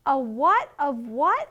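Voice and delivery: slow, loud, rising question; baffled asking back. Acted. Bunny who misheard his mother, baffled. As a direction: pitch leaps up on each "what".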